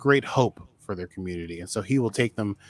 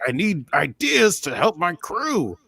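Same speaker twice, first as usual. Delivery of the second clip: slow, earnest imitated plea; imitating Saru; 7 semitones higher than usual. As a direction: plead slowly like Saru. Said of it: "he's like".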